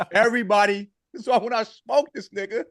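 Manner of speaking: excited taunting. Real, not acted. Taunting the doubters in chat.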